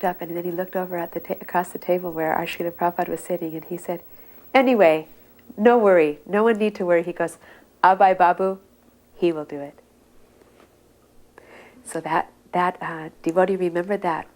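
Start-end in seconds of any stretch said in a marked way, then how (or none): 4.5-9.8 confident reassurance. Retold or imitated. Guru reassuring, naming Abhay.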